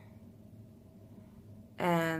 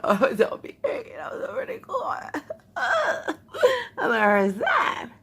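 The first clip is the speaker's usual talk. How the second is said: giddy laughter, high and squeaky; laughing; 14 semitones higher, 4 dB louder than usual. Real, not acted. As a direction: laugh through the words in disbelief. Laughing delight at lash success.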